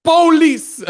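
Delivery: "Police" very high, loud and drawn out; exaggerated "Police". Streamer mocking how the singer says it, "Police" belted high. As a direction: belt "Police" with a long sung first syllable. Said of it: "ain't nobody say".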